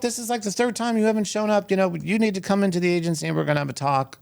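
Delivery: high-pitched scolding, rushing at the end; retold angry scolding. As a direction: scold him like a furious agent. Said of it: "called me up screaming".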